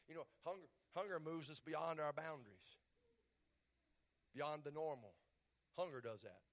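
normal talking voice

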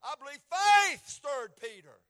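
slow, loud and high declaration; preacher's full-force line; loud preaching. Real, not acted. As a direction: proclaim it to the back pew. Preacher swells on faith.

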